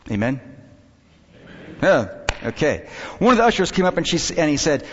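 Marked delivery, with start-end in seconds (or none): none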